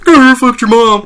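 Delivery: put-on high, loud, slow bratty kid taunt; mock twelve-year-old gamer. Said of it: "every twelve-year-old picks it up and is like".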